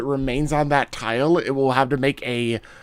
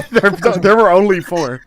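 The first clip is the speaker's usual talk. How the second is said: laughing through the words; 5 semitones higher, 8 dB louder than usual. Real, not acted. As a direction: laugh while you say it. GM laughing mid-sentence.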